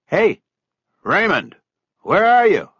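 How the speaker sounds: high loud call across the boat. Acted. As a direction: call out to the boy inside. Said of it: "he called out".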